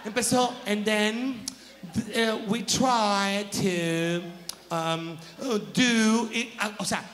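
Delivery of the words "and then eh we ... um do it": slow, halting English answer; hammed-up flustered contestant; fumbling contestant. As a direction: stumble through it like a lost pageant girl.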